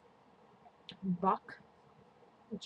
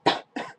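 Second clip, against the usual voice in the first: cough; 11 dB louder than usual. Real, not acted. Cough mid review.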